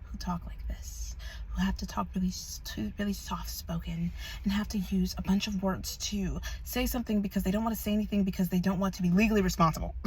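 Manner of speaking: hushed, overly gentle mock-sincere voice; fake soft-spoken. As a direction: imitate someone falsely soft-spoken, nearly whispering. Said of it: "have that fake voice when they talk like this".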